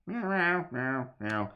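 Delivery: playful cat meows. Pretend. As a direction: meow like a cat.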